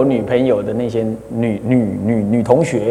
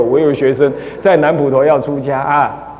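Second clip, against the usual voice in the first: ordinary talk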